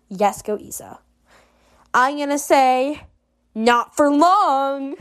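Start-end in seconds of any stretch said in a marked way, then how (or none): 3.5-5.0 very slow, stretched-out comeback; drawn-out taunt. Acted. Rival answering about the girlfriend, slow drawn-out taunt. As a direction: draw each word out teasingly.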